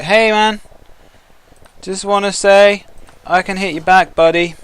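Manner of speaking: a hint of a scrappy skink; cocky skink voice. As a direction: give it a little swagger. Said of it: "He's gonna be like".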